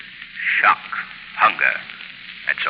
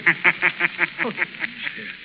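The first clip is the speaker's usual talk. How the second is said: eerie mocking laugh; 7 semitones higher, 8 dB quieter than usual. Shadow's mocking laugh in cave.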